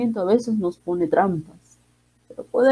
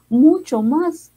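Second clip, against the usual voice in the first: "mucho más" drawn out slowly, higher and louder; stressed mucho más; 8 semitones higher, 5 dB louder, much slower than usual. Real, not acted. Speaker to grieving listeners, "mucho más" stretched slowly and loud.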